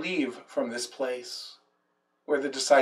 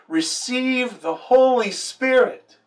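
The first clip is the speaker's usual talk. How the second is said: lifted proclamation; 7 semitones higher, 4 dB louder, slower than usual. Acted. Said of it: "he breathed on them and he said".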